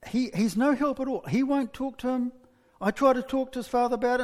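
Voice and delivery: high-pitched acted complaint; hammed-up worried venting; exasperated mother. Acted exasperated mother airing troubles.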